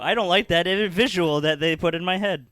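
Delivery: loud, high-pitched recoil; revolted outburst; real disgust. Real, not acted. Recoils at a gross image.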